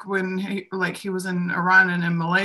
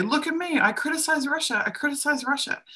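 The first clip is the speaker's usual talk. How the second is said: mock boast; 8 semitones higher than usual. Mocking self-promoting critic.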